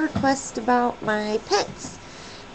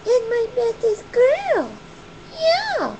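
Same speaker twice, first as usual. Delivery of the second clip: doting pet talk; 9 semitones higher than usual. Real, not acted. Owner telling Skylar she loves her, doting high baby talk. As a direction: gush to a beloved pet in a high voice.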